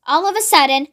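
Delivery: ordinary delivery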